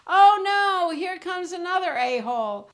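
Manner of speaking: high, loud cry of dismay; dreading chair. Shown as a demonstration. Chair seeing someone coming to sit, loud high dread. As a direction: wail as a put-upon chair. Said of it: "act out that chair".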